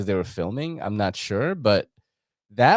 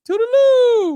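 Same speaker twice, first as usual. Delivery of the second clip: very high, loud singsong farewell; Mrs. Doubtfire; 8 dB louder, slower than usual. Pretend. Joking about dressing up as the movie nanny, high singsong call. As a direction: trill it out in a hammed-up high falsetto. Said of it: "dress up like Missus Doubtfire".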